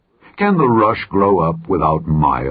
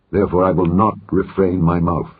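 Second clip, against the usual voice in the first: ordinary delivery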